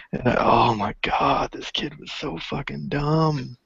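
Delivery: ordinary talk, nothing marked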